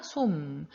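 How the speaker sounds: 솜 drawn out slowly onto a closed m; final ㅁ demonstration. Shown as a demonstration. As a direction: model 솜 with lips shut at the end. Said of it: "역시 미음이니까".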